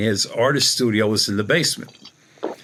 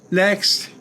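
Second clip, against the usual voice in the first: higher-pitched, brisk "next"; curt dismissal; 8 semitones higher than usual. Retold or imitated. Professor running the circle cuts off a heartfelt confession, curt dismissal. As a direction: dismiss them with one high word. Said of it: "She goes".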